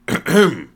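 loud, attention-getting throat clearing. A narrator's reading. Man interrupting the group, loudly clearing his throat. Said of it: "Sajin cleared his throat loudly".

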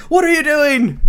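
high, loud startled cry of alarm; shocked yelp. Retold or imitated. King to uncle smashing his armor, startled high yelp. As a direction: squeak it out in a panic.

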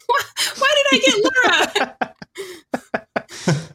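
high-pitched complaint through laughter; real helpless laughing wail; cracking up while protesting. Real, not acted. Laughing complaint about assigned role.

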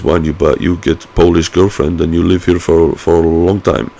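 lightly put-on Polish accent, slightly broken English; hint of a Polish accent in a retold remark; mock Polish accent. Quoting a Polish border guard.

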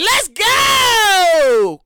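loud, high hype yell; hyped shout. Retold or imitated. Podcaster hyping himself up on Twisted Tea, loud shout. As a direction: shout it like the drink just kicked in.

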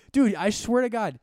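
high, loud, drawn-out exclamation; exasperated outburst. Acted. Ranting to a cohost over hash browns, exasperated outburst. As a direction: burst out in comic exasperation. Exasperated rant about Dunkin'.

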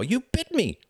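high, shocked accusation; indignant, high-pitched exclamation; shouting. A narrator's reading. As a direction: cry out at being bitten. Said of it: "he shouted".

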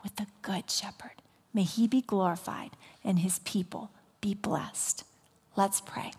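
hushed, reverent whisper; whispered blessing. Real, not acted. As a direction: breathe the blessing softly. Closing blessing before prayer.

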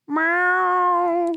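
long meow replying to its owner; cat meow reply. Pretend. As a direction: answer as the cat. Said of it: "we have conversations like this".

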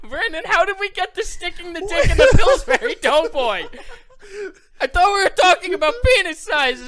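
high, loud laughing protest; laughing exasperated. Real, not acted. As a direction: protest while cracking up. Laughing outrage.

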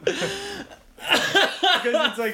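high, loud laughter through words; laughing. Real, not acted. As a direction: crack up while talking. Laughing hard.

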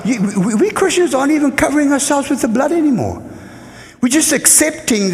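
rising, rapid exhortation; fervent rebuke. Real, not acted. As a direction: press it on them urgently. Preacher rebukes the church.

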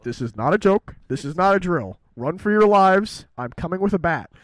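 very loud mock emergency warning; alarm shout. Announcer yelling a mock emergency to everyone, very loud. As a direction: blare it like a siren.

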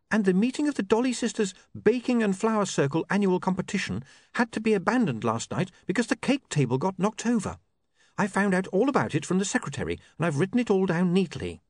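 put-on earnest girl passing on news; young woman Sacharissa. A narrator's reading.

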